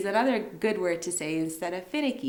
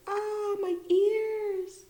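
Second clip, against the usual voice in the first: pained listener; much slower than usual. Pretend. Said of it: "you're gonna be like".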